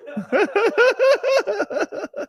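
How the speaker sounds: long, high-pitched rapid laugh; high giggle. Real, not acted. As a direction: crack up in a shrieky giggle. Host laughs at own pitch.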